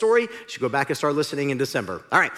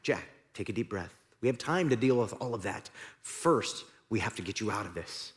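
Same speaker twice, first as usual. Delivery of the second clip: calm God voice; 5 semitones lower, 7 dB quieter than usual. Acted. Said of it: "the voice came back".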